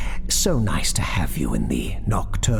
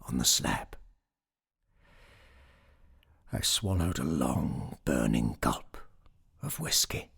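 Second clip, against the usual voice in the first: measured, softer storytelling voice; story narration; 7 dB quieter than usual. A narrator's reading. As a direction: tell the tale quietly, with deliberate pauses.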